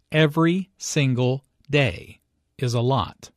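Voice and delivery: ordinary delivery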